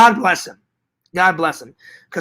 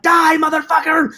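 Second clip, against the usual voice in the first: movie-villain shout; 10 semitones higher than usual. Retold or imitated. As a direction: yell it like an action-movie kill line. Acting out movie rocket launcher scene.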